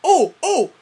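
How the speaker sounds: ordinary delivery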